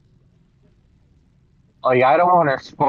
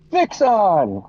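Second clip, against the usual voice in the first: happy call; 8 semitones higher than usual. Retold or imitated. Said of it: "so I was like".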